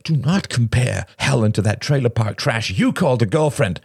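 high-pitched indignant outburst; angry hiss. A narrator's reading. Robert defends Helen against an insult, angry hiss. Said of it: "hissed Robert, his face red".

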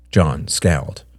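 plain reading voice

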